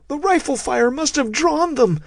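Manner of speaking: high, panicked cry; scared scientist yelling; terrified yell. A narrator's reading. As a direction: shriek it out in fear. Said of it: "the biologist yelled in terror".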